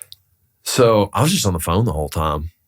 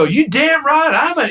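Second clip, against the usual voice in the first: high-pitched, heated retort; retold defiant comeback. Broker answering the tenant's jab, defiant. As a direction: fire back in a raised pitch.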